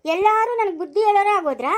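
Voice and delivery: furious that everyone lectures her; indignant shout. Acted. Mother-in-law outraged in quarrel. (ಸಿಟ್ಟಿನ ಕೂಗು)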